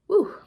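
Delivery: high, loud exhale of relief; loud woo. Real, not acted. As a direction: blow out a big woo. Pregnancy break, exhaling.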